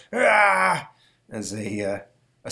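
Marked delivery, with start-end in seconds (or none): 0.1-0.9 short mock scream. Scream as professor swept.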